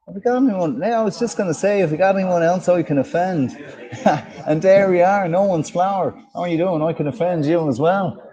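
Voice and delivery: lilting Dublin accent. Shown as a demonstration. Host looking for someone to offend, Dublin accent. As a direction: put on a playful Irish accent, Dublin way. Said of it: "This is one of my favourite accents, the, the, the Dublin".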